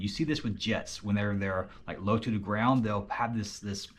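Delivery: low hushed voice; quiet at night. Real, not acted. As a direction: speak softly so no one wakes. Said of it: "trying to keep my voice kinda low".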